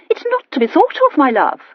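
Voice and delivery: high, breezy dismissal; lightly voiced chattering aunt, brushing it off; dismissive aunt.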